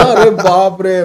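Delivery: acted "oh my god" astonishment; retold amazement. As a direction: imitate an awestruck friend, stretching the words.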